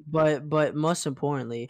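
usual speaking voice

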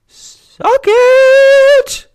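high, loud gloating yell; triumphant yell. Real, not acted. Gloating at scammers whose card charge got declined, loud yell. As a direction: crow over the scammers.

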